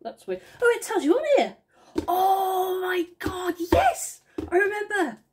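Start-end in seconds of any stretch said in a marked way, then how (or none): 2.1-5.2 high, slow rising oh my God; thrilled sudden recognition; excited realization. Real, not acted. Vlogger remembering the order, high and excited.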